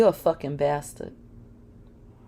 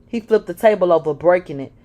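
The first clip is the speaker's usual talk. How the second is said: plain reading voice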